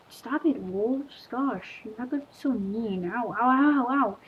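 usual speaking voice

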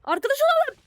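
high, loud demand to be friends; mock menacing shout. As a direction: bark it like a playful bully. Menacing friend offer in game. (alaycı tehditkâr bağırış)

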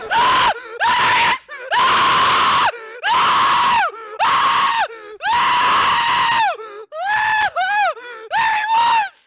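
high, loud terrified screams; terrified screaming. Real, not acted. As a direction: scream at the jump scare. Monster attacks, screaming.

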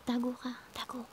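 very soft hushed whisper. Real, not acted. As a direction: whisper while hiding. Hiding in game.

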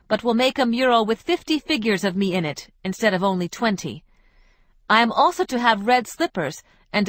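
Isabel's letter voice. Acted. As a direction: read it like a flighty woman leaving gaily.

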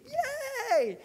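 high, joyful yelp; jubilant shout. Retold or imitated. Pastor acting George cheering at his wrecked car, high joyful yelp. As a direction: cheer like a man reborn. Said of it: "shouts in jubilee".

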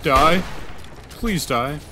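high, loud, panicky bursts; panicked pleading. Real, not acted. Player begging a game enemy to die, panicked. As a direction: plead loudly mid-fight, pitched well up.

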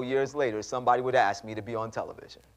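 ordinary delivery

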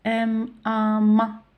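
teacher spelling out "M, A, ma" on a sustained tone; syllable sounding-out. Shown as a demonstration. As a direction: say "M, A", then blend it into a held "ma". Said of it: "ونقرأ في نفس الوقت".

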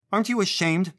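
loud, high-pitched scolding; indignant maternal outburst; yelling mother. A narrator's reading. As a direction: yell at a misbehaving child. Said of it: "the mother yelled at her".

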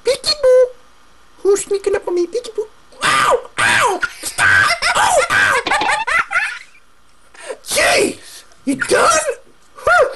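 high troll yelping ow; troll in pain. Acted. Troll crying out while being hit. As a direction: yelp under the blows, then a tired groan.

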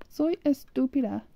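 normal talking voice

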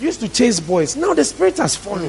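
high-pitched, impassioned preaching; fervent proclamation. Real, not acted. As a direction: build to a high exclamation. Preacher proclaims changed lives.

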